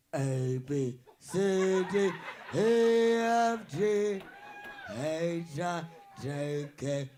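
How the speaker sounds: drawn-out, sinking alphabet; deep-voiced alphabet recital.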